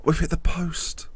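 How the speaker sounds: breathless whisper after a gasp, slow. Real, not acted. Manager watching his shot hit the post, gasped whisper. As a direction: gasp then whisper it, stunned.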